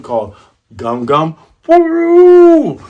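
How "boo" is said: high, loud drawn-out "boo"; long high hoot of sudden inflation; imitated balloon whoosh.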